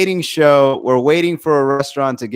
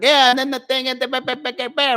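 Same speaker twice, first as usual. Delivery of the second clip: higher, breezy comic rattling with ba ba filler; mock joke telling; 8 semitones higher than usual. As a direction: skim through a joke on stage. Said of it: "I'm fucking saying jokes".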